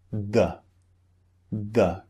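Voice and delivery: "the" said with a short "uh" vowel; schwa in THE. Shown as a demonstration. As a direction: say "the" to end in a neutral "uh". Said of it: "This sound appears in that word".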